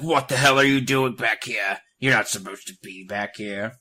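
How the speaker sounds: loud, imitated outraged shopkeeper; mock angry scolding. Shopkeeper catching girl in back room, mock angry. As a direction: burst in and scold, hammed up. Acts shopkeeper busting in angrily.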